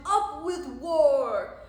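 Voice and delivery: very loud, drawn-out war cry; rowdy slow rallying shout; shouted slogan. A narrator's reading. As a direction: bellow it as a battle chant. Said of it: "And they shouted".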